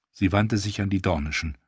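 ordinary delivery

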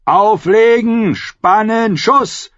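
high, drawn-out battle orders; shouted commands. A narrator's reading. Commander drilling archers in battle, shouted commands. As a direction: shout orders across the wall.

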